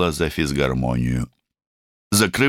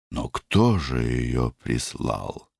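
frowning question; 4 semitones lower, 4 dB quieter, slower than usual. Acted. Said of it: "спросила она и нахмурилась".

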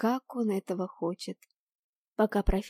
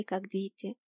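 ordinary delivery

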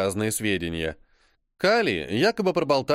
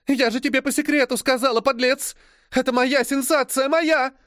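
high, loud outraged yell rising at the end; furious scream; 11 semitones higher, 3 dB louder than usual. Acted. Journalist screaming at John over her stolen scoop. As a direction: scream at him that the scoop was hers.

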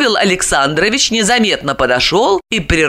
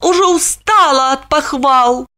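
feigned weary complaint, pitched higher; mock complaint; 7 semitones higher than usual. A narrator's reading. Olga pretending to complain to her stepson, mock weary. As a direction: pretend to be tired of praise. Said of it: "притворно жалуясь".

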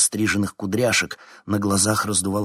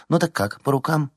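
brisk offer; 8 semitones higher than usual. A narrator's reading. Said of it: "поразился её хладнокровности".

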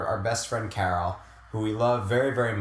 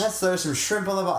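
mock Australian; 6 semitones higher than usual.

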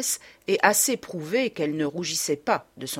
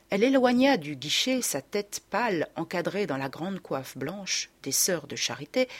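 ordinary delivery, nothing marked

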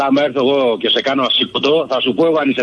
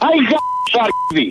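high-pitched cut-off curse; furious victim cursing before hanging up; angry curse. Real, not acted. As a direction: spit out the curse and hang up.

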